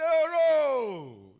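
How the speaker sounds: high, very loud, long-held wail; cry of pain. Acted. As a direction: wail out as Jesus in agony. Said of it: "It was painful".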